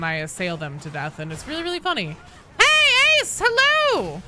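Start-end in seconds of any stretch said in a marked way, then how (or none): 2.6-4.2 high, loud, drawn-out hello; excited greeting. Real, not acted. Streamer greeting Ace as they arrive, excited high call. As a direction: call out a big stretched-out welcome.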